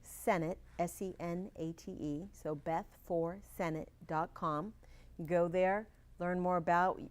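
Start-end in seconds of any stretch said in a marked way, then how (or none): 0.0-4.7 slow, deliberate dictation of a web address; unhurried, carefully separated words and letters. Shown as a demonstration. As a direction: give it out slowly so listeners can note it. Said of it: "repeat it nice and slowly".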